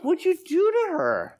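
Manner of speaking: high, startled question to his wife; alarmed and teasing; surprised. Retold or imitated. Husband finding wife with crying lady, surprised.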